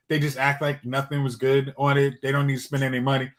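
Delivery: usual speaking voice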